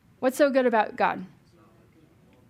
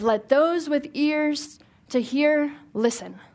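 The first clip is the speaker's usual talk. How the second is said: slow, higher, drawn-out proclamation; prophetic pronouncement; 5 semitones higher, slower than usual. Retold or imitated. Teacher imitating prophets calling to the crowd, slow and solemn. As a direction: intone it like an ancient prophet. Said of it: "the prophets ... going, like".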